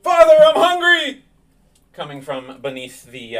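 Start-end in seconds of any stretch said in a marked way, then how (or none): 0.0-1.2 very high, strained scream; monstrous hungry cry. Acted. Hungry child calling to father from beneath the floorboards, monstrous scream. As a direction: scream it out like a starving thing below.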